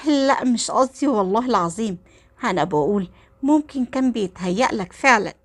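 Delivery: ordinary delivery, nothing marked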